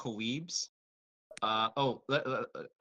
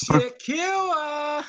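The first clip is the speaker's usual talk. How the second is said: shouting; 7 dB louder than usual. Acted. Calling out a character name.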